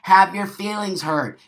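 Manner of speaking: usual speaking voice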